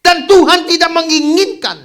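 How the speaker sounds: loud, high-pitched sermon climax; full-force preaching. Real, not acted. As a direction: proclaim it to the congregation at full force. Preaching climax about Henok. (berkhotbah berapi-api, lantang dan tinggi)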